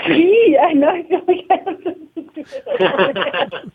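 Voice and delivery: real laugh through the words; giggling it out, a bit embarrassed; amused laugh while talking. Real, not acted. Laughing at co-everything title.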